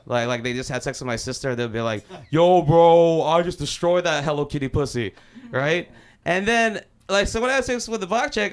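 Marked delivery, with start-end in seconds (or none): 2.3-5.1 boastful bragging. Retold or imitated. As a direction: imitate a roommate crowing about his conquest. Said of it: "they could not wait to brag".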